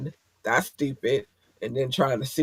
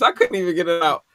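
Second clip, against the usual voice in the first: normal talking voice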